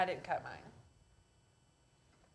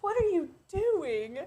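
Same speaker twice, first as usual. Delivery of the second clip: mock disbelief; 7 semitones higher, slower than usual. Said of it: "I'm like".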